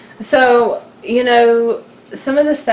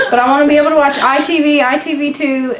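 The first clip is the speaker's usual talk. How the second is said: higher, louder, fast and pleading; fast excited rant; 7 semitones higher, 3 dB louder, faster than usual. Real, not acted. Wife pleading with listeners for British TV, fast and excited. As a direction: gush out the wish list in a rush. Ranting about British TV apps.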